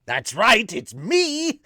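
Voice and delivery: loud villain reveal, pitch swooping high; Joker. Acted. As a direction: announce yourself with cackling-villain glee.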